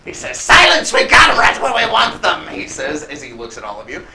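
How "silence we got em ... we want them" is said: high, loud villain rebuke; indignant kobold boss. Acted. Bandit boss hushing his own man, high and indignant. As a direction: silence your underling in a high shout.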